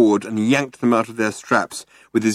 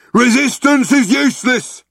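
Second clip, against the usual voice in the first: high, loud brutish guard's shout; bellowed; 5 dB louder than usual. A narrator's reading. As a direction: bellow it like a dim henchman. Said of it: "bellowed the guard".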